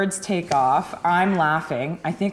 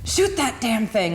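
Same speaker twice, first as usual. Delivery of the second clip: urgent; 5 semitones higher than usual. Retold or imitated. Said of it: "he's, like, freaking out".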